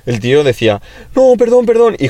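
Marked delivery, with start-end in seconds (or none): 1.2-2.0 high, loud mock pleading; retold pleading. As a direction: mimic someone begging for forgiveness. Kid pleading after being cornered.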